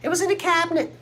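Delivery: high, sing-song mother voice; imagined mom on the phone. Pretend. Daughter imitates her mom announcing she found the vase, in a high sing-song voice. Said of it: "she'll call me".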